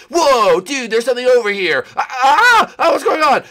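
high, loud cry of alarm; startled yell. Pretend. Finding something over here, startled yell. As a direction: yelp as if caught off guard.

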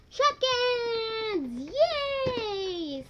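high, loud squeal of joy; excited cheer. Real, not acted. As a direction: cheer it out, thrilled. Excited unboxing reveal.

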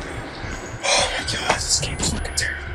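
whispered groan. Real, not acted. Groaning about the game.

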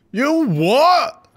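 high, loud outraged; shocked outburst. Retold or imitated. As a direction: blurt it in disbelief. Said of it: "He's just like".

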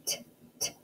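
T, t as a breathy voiceless t sound; isolated voiceless t; letter sound. Shown as a demonstration. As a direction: whisper the t sound. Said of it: "Can you say tree?".

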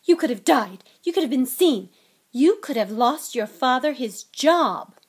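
loud motherly telling-off, each accusation hit hard; angry yelling. Acted. Mother berating her sons over their escapade, yelling angrily. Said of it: "yelled Missus Weasley".

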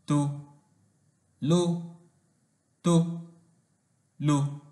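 "to", "lo", "to", "lo" each said apart; syllable by syllable. Shown as a demonstration. Teacher splits tolo tolo into syllables, slow and separate. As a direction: tap out each syllable with pauses. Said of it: "They are".

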